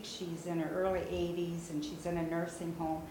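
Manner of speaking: ordinary talk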